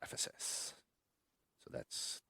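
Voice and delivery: breathy whispered Ephesus. Real, not acted. Host repeating the right answer under breath, whispered.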